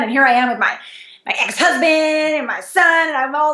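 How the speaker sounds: ordinary delivery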